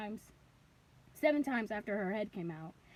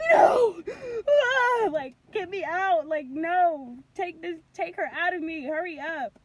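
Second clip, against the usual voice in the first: high panicked wail, easing into pleading; losing it; 5 semitones higher, 10 dB louder than usual. Retold or imitated. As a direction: re-enact screaming, then begging in labor. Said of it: "I lost it".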